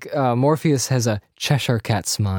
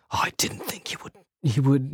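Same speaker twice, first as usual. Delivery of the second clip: imitating Cypher; 6 dB quieter than usual. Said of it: "Cypher actually tells".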